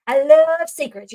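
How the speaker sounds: loud, stretched-out LOVE; slow, gleeful mock delight; mimicked childlike relish. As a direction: imitate a kid delighted by gossip. Voicing child thrilled by a secret.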